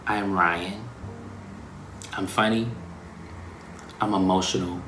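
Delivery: soft, slow, reflective list of who I am; introspective, hushed self-description; quiet self-affirmation. Retold or imitated. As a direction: speak each trait gently, pausing between. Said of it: "I would say".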